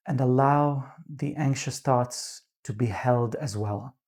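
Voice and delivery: calm, unhurried meditation voice with long pauses; guided meditation. Real, not acted. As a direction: gently guide them into grounding, leaving space between phrases.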